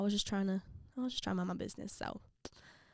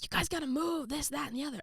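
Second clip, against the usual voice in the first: higher-pitched imitation of coach; lightly mocked coach patter, pitched up; coach nagging; 7 semitones higher than usual. Coach hurrying losing team, nagging. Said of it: "the coach is like".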